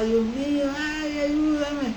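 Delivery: high, drawn-out cry for help; retelling the damned crying out in hell, high and slow; anguished wailing. Retold or imitated. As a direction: wail it slowly, high and pained. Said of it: "mucha gente lloraba y gritaba".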